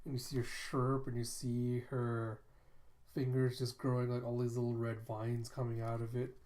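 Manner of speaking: ordinary talk, nothing marked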